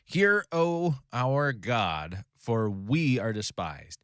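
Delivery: slow, solemn prayer read aloud; scripture reading. Acted. Teacher reading Nehemiah's prayer to the class, slow and solemn.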